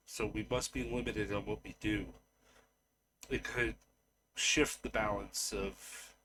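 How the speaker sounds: soft, halting answer in character; hesitant Genesis. Acted. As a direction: explain it carefully, quietly, with long pauses. Game master plays Genesis.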